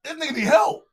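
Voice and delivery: high, loud call for help. Retold or imitated. Coworker sees a man vomiting, calls loud and high. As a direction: shout out to get someone's attention. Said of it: "So I said".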